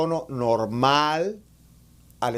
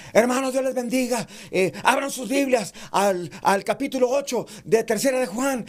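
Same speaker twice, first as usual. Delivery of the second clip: grito de predicador, muy agudo; predicador gritando; 9 semitones higher than usual. Shown as a demonstration. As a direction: arranca el sermón a gritos. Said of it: "todo tu sermón es gritando". (saludo de sermón gritado)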